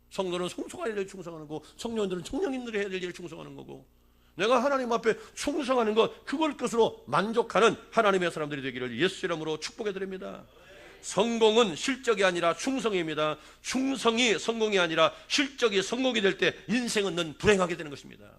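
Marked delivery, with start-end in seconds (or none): 4.4-10.4 louder, higher preacher's blessing; fervent blessing. Real, not acted. Pastor blesses the congregation. 11.0-18.3 emphatic preaching. Real, not acted. Preacher driving home the point.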